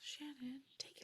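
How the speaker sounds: soft whispered reply. Pretend. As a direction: play along in a hush. Whispers back to cohost.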